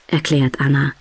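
ordinary delivery, nothing marked

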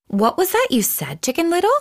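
a hen's questioning voice climbing high at the end; a hen asking what she just heard; curious hen. Acted. Henny Penny asking Chicken Little, curious hen voice rising at the end.

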